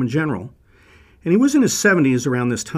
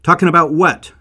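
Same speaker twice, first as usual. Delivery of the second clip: higher, louder, impatient demand; demanding shout; 5 semitones higher, 8 dB louder than usual. Acted. Leader outside presses the guard, loud and impatient. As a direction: press him hard for an answer.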